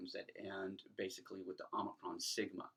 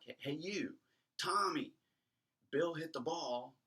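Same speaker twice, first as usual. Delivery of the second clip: calling out to someone; 7 semitones higher than usual. Shown as a demonstration. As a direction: hail a friend from far away. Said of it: "When you call to somebody".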